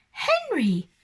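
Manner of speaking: high, sharp cry of a name; cried out. A narrator's reading. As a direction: call out in alarm. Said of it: "cried Missus Brown".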